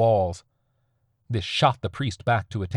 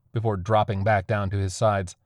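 ordinary delivery, nothing marked

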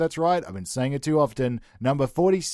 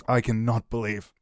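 usual speaking voice

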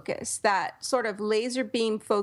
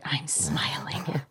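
soft slow whisper, softer; 7 semitones lower, 4 dB quieter, slower than usual. Real, not acted. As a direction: breathe it out slowly in a whisper. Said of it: "If you can't hear it".

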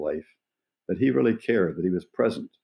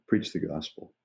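lightly imitated frail old man, softer; weak, frail voice; 4 semitones lower, 6 dB quieter than usual. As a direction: say it quietly, like a deaf old man with little breath left.

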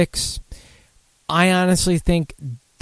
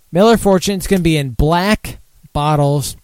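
ordinary talk

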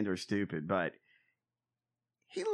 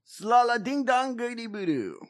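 gibberish chant, pitched up; speaking in tongues; 8 semitones higher, 3 dB louder than usual. Pretend. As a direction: babble like a possessed preacher. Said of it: "I don't think he speaks, uh, in tongues".

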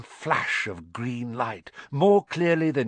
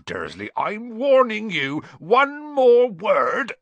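higher, louder, slow bellowed threat; roared warning; 11 semitones higher, 8 dB louder, slower than usual. A narrator's reading. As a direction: explode at him. Hagrid threatens Vernon with umbrella.

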